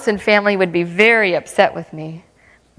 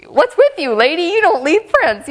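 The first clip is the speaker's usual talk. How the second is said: puzzled official; 7 semitones higher than usual. Retold or imitated. Airport clerk asking the lady about her prints, higher incredulous. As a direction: play the baffled fingerprint clerk.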